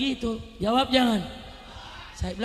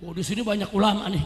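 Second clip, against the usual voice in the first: normal talking voice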